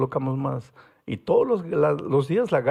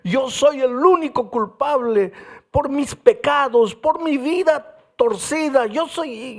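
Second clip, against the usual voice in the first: retold wailing man; 8 semitones higher, 3 dB louder than usual. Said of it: "llorando y decía".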